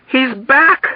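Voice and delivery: high-pitched alarmed cry; screamed alarm. A narrator's reading. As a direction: cry it out as a startled warning. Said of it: "Dunbar screamed again".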